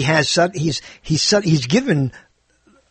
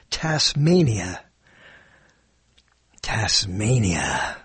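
slow, drawn-out name, sinking lower on the repeat; savoring the sound; 5 semitones lower, 4 dB quieter, much slower than usual. Shown as a demonstration. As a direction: linger over every sound of the name. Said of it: "It just says so much in the sound".

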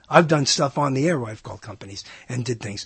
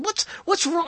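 retold exasperation; 13 semitones higher, 3 dB quieter than usual. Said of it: "I got really".

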